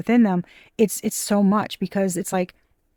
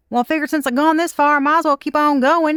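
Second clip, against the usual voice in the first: hammed-up Southern simpleton voice; Forrest Gump; 8 semitones higher, 6 dB louder than usual. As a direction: play the simple man who just keeps running. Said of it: "fucking Forrest Gump".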